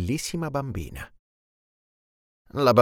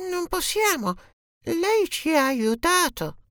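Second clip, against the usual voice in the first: tiny ant voices. Acted. Ants refuse the genie in tiny high voices. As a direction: squeak it out like a small ant. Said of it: "le formiche risposero".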